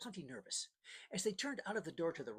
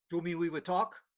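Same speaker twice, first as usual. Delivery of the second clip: a touch of anger, pushed a bit louder; angry; 9 dB louder than usual. A narrator's reading. Cuban smuggler taking offense at the boat captain, mildly angry. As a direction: bristle at the insult, lightly. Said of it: "He, he was angry".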